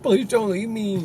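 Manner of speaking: high, half-joking plea; mock crying lady. Friends joking, imitating La Llorona's high plea. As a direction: play the wailing ghost lady lightly. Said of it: "some lady crying".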